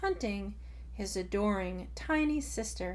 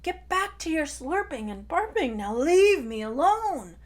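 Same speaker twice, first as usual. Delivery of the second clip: higher, loud irritated scolding; angry brother; 7 semitones higher, 8 dB louder than usual. Acted. Tai sends his pest sister away, loud irritated scolding. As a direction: shoo her off in a huff.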